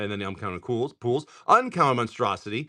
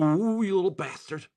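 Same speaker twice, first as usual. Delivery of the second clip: playful mock insult; 7 semitones higher, 3 dB quieter than usual. Real, not acted. Teasing a pulled card.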